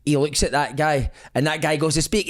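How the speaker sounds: usual speaking voice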